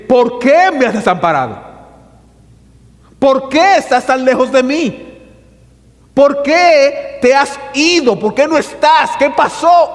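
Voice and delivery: David's anguished cry, rising to a shout; desperate cry. Acted. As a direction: cry out in despair at God. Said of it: "es un grito desesperado".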